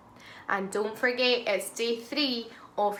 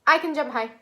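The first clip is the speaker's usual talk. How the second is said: quick; 4 semitones higher, 7 dB louder, much faster than usual. Shown as a demonstration. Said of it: "Let's say it really quickly".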